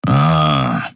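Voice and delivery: plain reading voice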